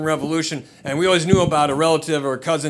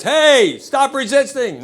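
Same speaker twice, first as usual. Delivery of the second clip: higher, louder, slow cop command; mock cop; 10 semitones higher, 5 dB louder, much slower than usual. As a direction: bark it like a cop on a chase. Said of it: "the greatest hits".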